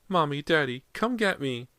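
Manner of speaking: put-on high, frightened child calling for his parents; little boy crying out in the dark; scared little boy. A narrator's reading. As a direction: cry out like a terrified small boy.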